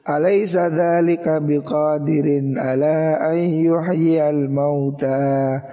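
very slow chanted verse; Quran recitation. Acted.

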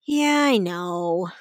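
slow, low, drawn-out acknowledgement; retold unimpressed reaction, stretched out; underwhelmed. Retells underwhelmed reaction in cinema.

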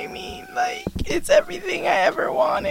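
high, breathy mock sob; crying voice. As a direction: whimper it like a mock cry. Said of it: "Not the crying voice".

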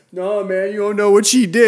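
loud, high pleading excuse; imitated protesting boyfriend. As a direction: act out a man protesting to an angry dad. Voicing boyfriend protesting to dad.

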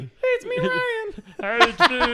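very high squeaky imitation of Ryan; mocking falsetto with laughter; squeaky falsetto mockery. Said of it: "I don't sound like that".

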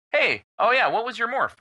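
breezy, offhand question; lightly voiced casual ask. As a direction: toss it off like it's nothing. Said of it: "Just so casually".

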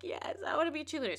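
high, quick imitation of a crying child; mock tearful. Crying girl answers her exasperated dad, high mock-tearful voice. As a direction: play a little girl owning up through tears. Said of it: "because I'm crying".

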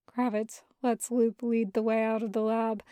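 usual speaking voice

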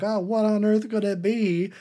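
high, loud mock wonder; hammed-up innocent curiosity; wondering Paddington. Acts out Paddington's wondering reply.

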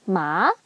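"ma" sliding upward; rising second tone. Shown as a demonstration. Teacher showing second tone, "ma" rising. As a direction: lift "ma" from middle to high. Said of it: "is a high rising tone".

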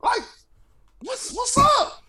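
high, exasperated yelling; incredulous shout. Retold or imitated. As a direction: yell it in disbelief. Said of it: "I was like".

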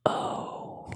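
whispered oh; whispering. Real, not acted. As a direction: breathe it out. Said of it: "It's 'cause I'm whispering".

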